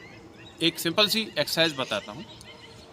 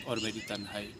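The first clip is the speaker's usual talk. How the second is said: Bachchan recitation; 7 semitones lower, 9 dB quieter than usual. Acted. Teacher first reciting the line softly as Bachchan. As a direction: breathe out the words softly. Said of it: "Amitabh Bachchan साहब का".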